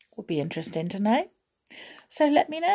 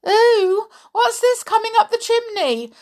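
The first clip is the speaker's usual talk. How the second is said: much higher, louder squeal of alarm; a startled little doll voice; high startled cry of a toy; frightened doll; 9 dB louder than usual. Acted. Doll frightened by sweep's brush.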